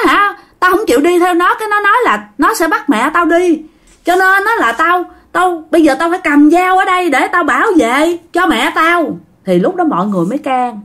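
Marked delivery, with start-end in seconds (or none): 0.1-9.2 a frightened madman insisting someone wants to take him; crazed uncle crying out. Retold or imitated. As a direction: uncle cries out to the family in high panic. Uncle voiced in retold scene.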